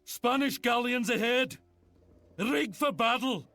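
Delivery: high, urgent call to the crew; high-pitched shouted order; battle call. Acted. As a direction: sound the alarm across the deck. Ship's captain calling out to crew.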